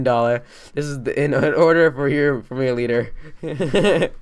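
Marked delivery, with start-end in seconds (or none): 3.4-4.1 laughing. Real, not acted. Mocking laugh at the acronym.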